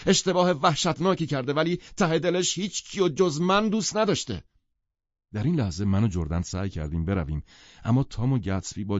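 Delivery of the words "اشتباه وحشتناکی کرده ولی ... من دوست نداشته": high-pitched, impassioned outburst; agitated shout. A narrator's reading. Gatsby telling the husband she loves only him, agitated shouting. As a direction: keep shouting it at him, worked up. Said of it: "برانگیخته از جایش بلند شد و داد زد".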